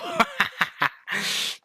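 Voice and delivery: high cackling laugh; delighted laugh. Real, not acted. Laughing at own success, high delighted laugh. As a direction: let out a high, gleeful laugh.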